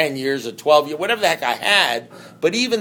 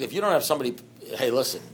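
ordinary talk, nothing marked

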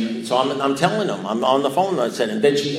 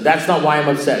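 louder, higher-pitched disbelief; incredulous protest; 4 semitones higher, 6 dB louder than usual. Retold or imitated. Caller challenging his sponsor's verdict, loudly incredulous. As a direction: throw the words back at him, indignant.

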